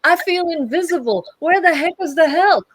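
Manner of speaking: high, loud mock complaint. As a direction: whine loudly to the whole bank. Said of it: "if you went in there and go".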